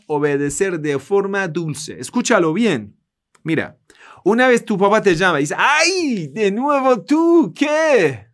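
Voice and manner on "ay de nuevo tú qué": high-pitched, exasperated whine; annoyed son complaining, much higher and drawn out; bitter grudging reply. Acted. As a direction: sigh it out like a fed-up child to his father. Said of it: "pero de forma amarga".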